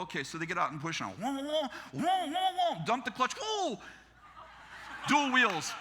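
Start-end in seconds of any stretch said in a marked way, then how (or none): 1.1-2.7 high whooping engine revs; imitated engine revving. Imitates revving the stuck truck. 3.3-3.8 high-pitched whoa for the truck lurching; short, high engine whoop; imitated truck whoop. Imitates truck as clutch drops.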